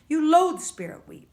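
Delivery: pointed, forceful charge; accusing. A narrator's reading. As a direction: throw it at them as an accusation. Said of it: "Missus Vargas accuses".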